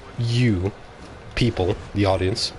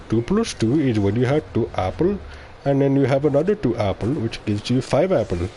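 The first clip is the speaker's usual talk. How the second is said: put-on Indian accent, lilting tutorial delivery; mock maths tutor in an Indian accent; 3 semitones higher than usual. Streamer parodying a YouTube maths tutor, put-on Indian accent. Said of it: "That Indian accent is garbage".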